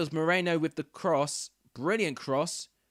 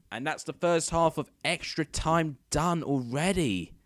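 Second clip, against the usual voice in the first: excited commentary; 4 semitones higher, 4 dB louder than usual. Real, not acted. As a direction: announce the score with building excitement. End of extra-time half.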